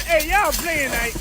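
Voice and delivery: usual speaking voice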